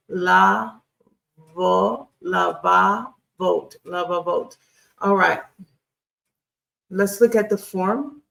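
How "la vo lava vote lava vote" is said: "la", "vo", then "lava, vote", sounded out one syllable at a time. Shown as a demonstration. Teacher sounding out the plural for students, syllable by syllable. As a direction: break the word into its syllables, then blend them. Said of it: "change that to the pluralization".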